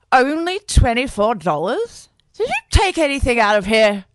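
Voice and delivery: a hint of a drunk mum interrogating her son; slurring drunk mother. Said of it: "his mum slurred at him".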